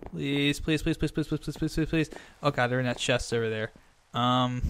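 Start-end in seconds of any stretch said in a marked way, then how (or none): 0.1-2.1 rapid pleading. Real, not acted. Player begging the horse to stay, rapid pleading. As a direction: beg in a rattled-off chant.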